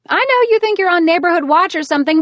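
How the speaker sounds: bright high scolding woman; heroine voice. A narrator's reading.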